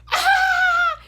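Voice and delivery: loud, high-pitched shriek of laughter; mock burst of laughter. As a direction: imitate someone exploding with laughter, very high. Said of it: "bursted out laughing".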